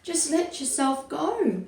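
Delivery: high, coaxing call from below; crowd calling. A narrator's reading. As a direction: urge someone to jump. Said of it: "the crowd called that had gathered below".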